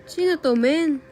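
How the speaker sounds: loud, high-pitched disbelieving question, then easing; baffled outburst. Real, not acted. Gamer baffled by an unreadable name, exclaiming in confusion. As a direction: call out in real confusion, voice jumping up.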